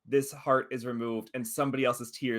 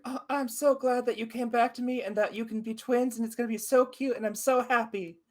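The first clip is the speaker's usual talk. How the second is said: high, gushing fairy voice; high excited fairy babble; 10 semitones higher than usual. Acted. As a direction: squeal with delight like an overjoyed little fairy.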